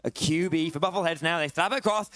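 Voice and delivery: normal talking voice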